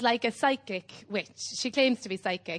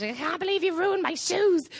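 higher, mock-outraged shriek; mock angry sister; 9 semitones higher than usual. Sister furious over ruined shoes, a mock outraged squeal. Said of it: "She went mad".

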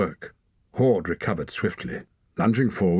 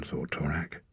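dropped to near whisper; hushed aside; 3 semitones lower, 8 dB quieter than usual. A narrator's reading. Narrator tags Torak's thought, hushed under the breath.

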